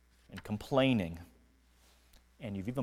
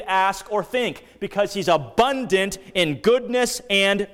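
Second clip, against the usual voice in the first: raised, loud, impassioned preaching; preaching at full force; 6 semitones higher, 6 dB louder than usual. Acted. Preacher exhorting his congregation, full impassioned force. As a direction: lift it up and proclaim God's covenant glory.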